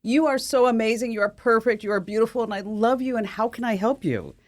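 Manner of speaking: high, sugary gushing praise; gushing affirmation Siri. Retold or imitated. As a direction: pour on the compliments like a syrupy assistant. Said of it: "the positive affirmation sandwich Siri".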